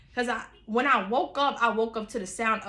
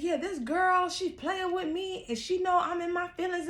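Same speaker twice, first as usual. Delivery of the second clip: imitating Rose upset; 8 semitones higher than usual. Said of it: "she's like".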